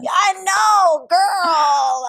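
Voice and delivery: excited squeal. Real, not acted. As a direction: squeal it in delighted agreement. Agreeing emphatically with her friend.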